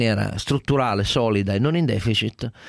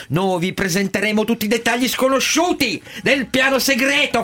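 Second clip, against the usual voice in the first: mock pompous proclamation; 8 semitones higher than usual. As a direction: proclaim it like regime propaganda. Ironic pompous regime praise.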